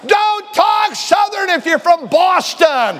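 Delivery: high, loud, slowed exclamation; half-laughing outburst; preaching shout. Real, not acted. Preacher shouting playfully at Southern pretenders in the pews.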